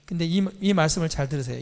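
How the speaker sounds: usual speaking voice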